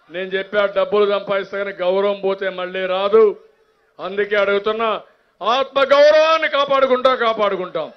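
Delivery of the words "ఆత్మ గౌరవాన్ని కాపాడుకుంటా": high, loud pledge; loud rally pledge; rousing shout. Real, not acted. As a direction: lift it up to the crowd. Rallying on self-respect.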